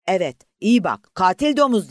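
loud, high hateful shout; angry yell. Acted. A man in the lifeboat screaming at the enemy, loud and hateful. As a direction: scream the insult at the ship. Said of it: "diye haykırdı".

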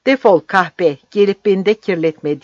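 loud furious roar. A narrator's reading. Captain pushing the woman away, loud furious roar. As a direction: roar her out of your sight. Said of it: "diye kükredi".

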